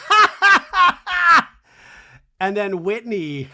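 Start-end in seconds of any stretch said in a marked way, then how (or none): 0.1-1.5 very high, shouted mock laugh; imitated loud guffaw. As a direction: blast out a huge fake cackle. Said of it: "And Heather goes".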